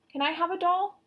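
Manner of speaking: put-on a touch of a small child's pleading voice; lightly voiced eager little girl; little girl asking. A narrator's reading. Little sister asking for a doll, lightly childlike.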